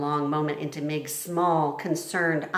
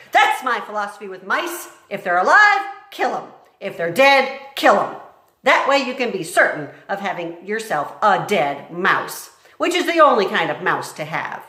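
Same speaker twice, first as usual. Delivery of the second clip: loud, higher gloating; harsh cook; 4 semitones higher, 7 dB louder than usual. Acted. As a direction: relish every kill. Cook explaining philosophy.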